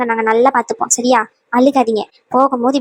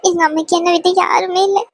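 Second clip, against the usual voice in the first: sobbing between phrases, pitch raised; crying; 4 semitones higher than usual. Acted. Bride telling her husband she wants her mother, crying. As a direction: plead through tears.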